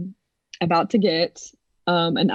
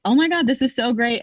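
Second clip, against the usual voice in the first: higher, lightly thrilled; higher excited gushing; excited doctor; 6 semitones higher than usual. Retold or imitated. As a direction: gush over the news. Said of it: "he was like".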